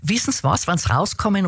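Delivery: imitated Viennese porter; Viennese accent. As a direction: put on a Viennese dialect for the porter. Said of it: "ich versuch's jetzt einmal auf, auf Wienerisch".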